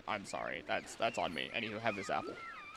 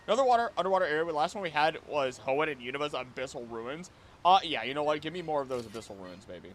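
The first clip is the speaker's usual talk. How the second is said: ordinary delivery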